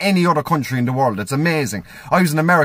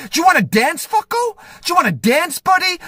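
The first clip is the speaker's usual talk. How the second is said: aggressive muscly man. Retold or imitated. Comic mimicking the angry driver at his car window, mock aggressive. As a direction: square up to him in a hammed-up threat. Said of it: "was like".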